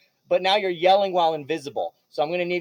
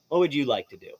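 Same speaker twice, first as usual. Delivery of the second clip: ordinary delivery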